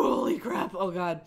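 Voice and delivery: ordinary talk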